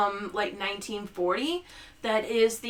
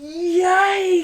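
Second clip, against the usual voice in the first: yikes; 8 semitones higher, 7 dB louder than usual. Real, not acted.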